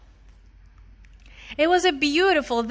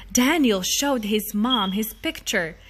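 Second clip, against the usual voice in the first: ordinary delivery, nothing marked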